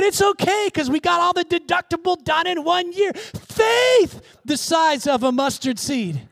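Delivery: high, ringing, emphatic sermon delivery; preaching at full force. Real, not acted. Preacher urging faith on struggling listeners, impassioned. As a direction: drive each phrase out to the congregation.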